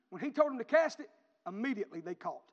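usual speaking voice

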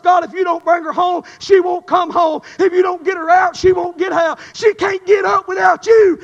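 loud pleading cry to God; retold desperate prayer. Preacher reliving his plea to God for his mother, loud and pleading. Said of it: "we got honest".

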